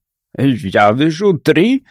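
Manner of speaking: plain reading voice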